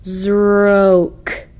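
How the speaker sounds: Zroke stretched slowly, sound by sound; drawn-out Zroke. Shown as a demonstration. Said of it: "The nonsense word should be read like this".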